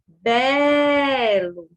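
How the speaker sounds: "Belo" with BE stretched very long, LO short; stressed syllable drawn out. Shown as a demonstration. Teacher showing which syllable drags, "Belo" with BE held long. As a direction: call out "Belo", holding the first syllable. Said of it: "Aquela sílaba que se arrasta".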